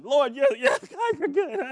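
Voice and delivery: high, broken imitation of sobbing; mock boo-hooing. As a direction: imitate blubbering praise through fake sobs. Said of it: "just boo-hooing".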